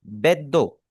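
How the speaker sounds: बेदो as bet-do, d held double; बेदो with a clear geminate d; doubled d. Shown as a demonstration. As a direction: stop on the d of बेदो, then release. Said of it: "डी के ऊपर एक शद लगी हुई है".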